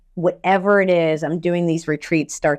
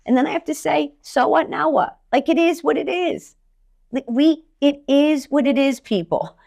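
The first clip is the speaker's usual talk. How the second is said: much higher, punchy tough love; high energy pep talk; 8 semitones higher than usual. Real, not acted. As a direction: shake the listener out of self-pity, pitched high.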